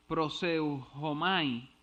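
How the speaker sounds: "proseujomai" spelt out very slowly, syllable by syllable; careful Greek word. Shown as a demonstration. Preacher attempting the Greek word for pray, halting. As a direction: sound out "proseujomai" carefully after false starts. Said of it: "voy a tratar".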